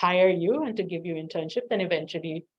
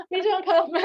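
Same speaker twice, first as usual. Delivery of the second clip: laughing; 10 semitones higher, 4 dB louder than usual. Real, not acted. Calling colleague over, laughing.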